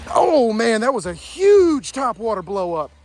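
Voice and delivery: high-pitched thrilled gasps over a big fish; can't believe the catch, voice jumping up; real excitement. Real, not acted. Huge bass hits top water lure.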